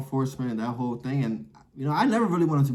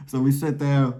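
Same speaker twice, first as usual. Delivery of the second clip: ordinary delivery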